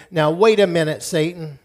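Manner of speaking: bold, emphatic rebuke to the enemy; confident preacher facing down Satan; defiant declaration. Shown as a demonstration. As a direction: stand your ground and declare it forcefully. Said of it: "I want you to say".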